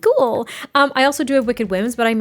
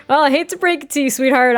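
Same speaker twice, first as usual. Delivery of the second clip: laughing through a teasing line, quick and louder; smug laugh; 5 semitones higher, 3 dB louder than usual. Retold or imitated. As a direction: tease her with a chuckle. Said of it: "And he's like".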